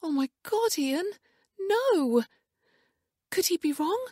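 very high, distressed outcry; horrified. A narrator's reading. Wife learning they share a father, horrified. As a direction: cry out in horror, pitch soaring.

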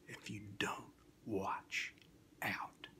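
spooky hushed warning; whisper. A narrator's reading. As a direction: breathe the warning out. Spooky refrain ending.

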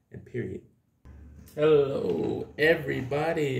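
ordinary delivery

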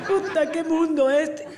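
put-on slow, wailing drunken lament; hammed-up sobbing drunk reading aloud; tearful drunk. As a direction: drag the words out through mock tears. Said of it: "empieza a llorar".

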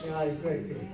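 "I got a great victory" dropped soft; quiet echo. Real, not acted. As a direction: let the echo fall away quietly. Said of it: "repeated line".

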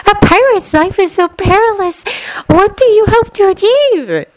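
high, dreamy fangirl voice; lovestruck schoolgirl. A narrator's reading. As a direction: ask him, starry-eyed. Swooning girl voiced high.

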